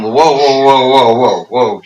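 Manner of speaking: halting someone with repeated whoas; mock objection. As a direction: put the brakes on someone, playfully. Acts out objecting to advice.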